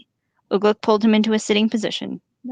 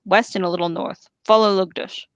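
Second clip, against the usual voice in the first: usual speaking voice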